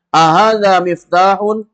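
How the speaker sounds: teacher models question for class; slow model phrase. Shown as a demonstration. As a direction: lead the class slowly. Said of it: "drill prompt for repetition".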